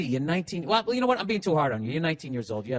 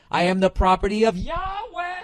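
fervent cry; 7 semitones higher than usual. Retold or imitated. Arrested man's climactic yell.

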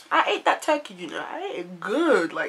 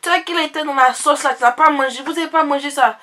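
loud, high, sure-of-herself auntie; confident auntie; 4 semitones higher, 9 dB louder than usual. Retold or imitated. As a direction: insist it like it's obvious. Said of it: "said it with so much confidence".

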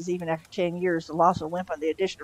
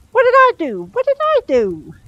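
very high, loud cry of innocence; indignant protest; 9 dB louder than usual. Acted. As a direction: protest loudly, voice shooting way up. Accused demon protests his innocence.

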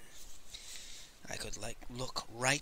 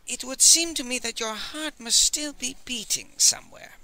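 higher, musing scholar voice; thoughtfully; 10 semitones higher, 6 dB louder than usual. A narrator's reading. Master Li probes the Old Man's secret, high and thoughtful. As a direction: probe gently, thinking aloud. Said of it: "said thoughtfully".

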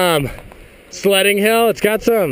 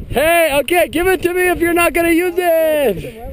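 raised voice asking for the sled; calling out; 7 semitones higher than usual. Real, not acted. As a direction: shout up to get the sled.

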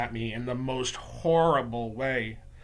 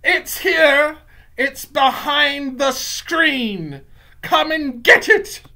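very high, loud gloating witch cry; shrill, slow witch's shout of discovery; triumphant yell; 12 semitones higher, 11 dB louder, much slower than usual. Acted. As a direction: yell it out in triumph, drawing out the words. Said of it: "yelled triumphantly".

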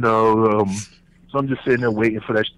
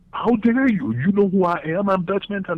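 How dare you pitched much higher, outraged; high indignant outrage; 9 semitones higher than usual. Retold or imitated. Dutch outraged that a nobody talks back, high-pitched. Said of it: "you know the look".

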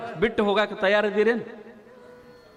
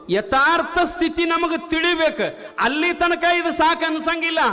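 high, ringing sermon voice; rising pulpit proclamation; fiery preaching; 11 semitones higher than usual. Acted. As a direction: preach it out to the crowd. Preacher raises voice.

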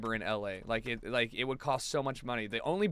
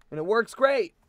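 ordinary delivery

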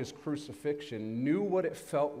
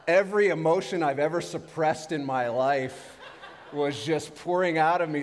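laughing; 4 semitones higher, 6 dB louder than usual. Real, not acted. Laughing about crying on anesthesia.

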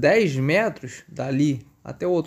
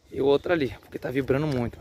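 ordinary delivery

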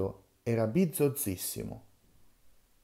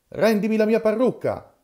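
loud, much higher yell, stress on mia; shouted; 11 semitones higher, 8 dB louder than usual. Acted. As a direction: yell for the wig back. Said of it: "gridò Mastrantonio".

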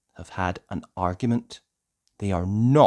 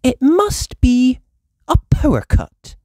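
Mummy Pig voice; 8 dB louder than usual. A narrator's reading.

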